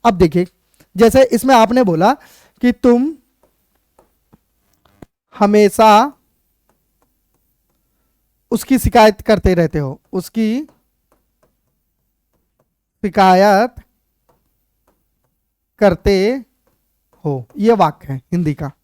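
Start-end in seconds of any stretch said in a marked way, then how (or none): 2.6-6.1 word-by-word dictation. Real, not acted. Dictating a sentence while writing it. 10.1-17.5 word-by-word dictation. Real, not acted. Dictating a sentence while writing it.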